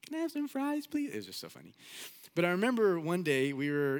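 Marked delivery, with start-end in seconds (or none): 0.0-1.1 put-on very high, squeaky little-kid voice; imitated tiny boy, pitched way up; little five-year-old brother. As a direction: beg like a small child at a drive-through. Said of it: "you know, five".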